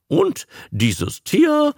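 high, slow, grand announcement; a showman ceremoniously introducing the marvel. Acted. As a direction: build up the big reveal. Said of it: "hob feierlich die Stimme".

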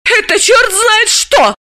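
very high, loud fury; enraged woman. Acted. Dalila, furious and shrill, berates the journalists. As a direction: blow up at them. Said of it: "разъярилась Далила".

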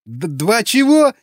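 high, slow, stammered query; bewildered question. A narrator's reading. Prisoner does not understand interrogator, bewildered question. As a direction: stumble on the first sound, rising high in confusion. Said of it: "Черновик растерянно уставился".

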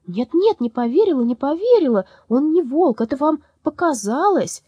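high, loud eager denial; protesting. Acted. Lyolya protesting she does not believe, high and loud. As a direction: insist hotly.

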